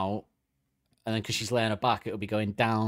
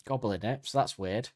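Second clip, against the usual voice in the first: normal talking voice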